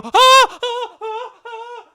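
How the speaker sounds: high, loud panicked scream, fading; terrified child scream. Retold or imitated. A scared kid screaming at the paused screen. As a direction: shriek in terror. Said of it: "and I fucking go".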